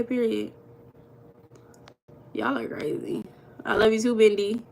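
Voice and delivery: ordinary delivery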